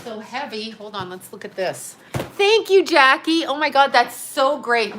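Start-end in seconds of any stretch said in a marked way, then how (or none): 2.1-5.0 high, loud, gushing thank-you; delighted thanks. Real, not acted. Thanking Jackie for the care package, delighted. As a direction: gush with real delight, starting high.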